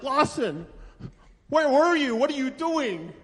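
high, urgent questioning; terrified parent. Acted. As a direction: demand answers after a bad scare. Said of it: "I was terrified".

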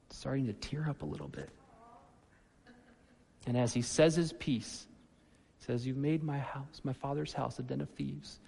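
soft tearful. Retold or imitated. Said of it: "They depict Jesus".

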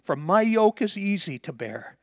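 loud, high, ringing preacher's voice; preaching at full force. Acted. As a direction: lift the scripture to the rafters.

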